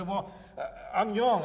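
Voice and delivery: preacher voicing young people's excuse, mocking and loud; mock youthful excuse. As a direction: toss it off like a cocky kid. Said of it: "you say".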